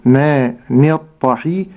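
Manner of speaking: الطحي slow, with a clear kasra and no doubled حاء; slow recitation demo. Shown as a demonstration. Teacher showing the kasra of the ha slowly. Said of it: "أبين كسرة الحاء ولا أشدد الحاء".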